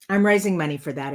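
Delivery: ordinary talk, nothing marked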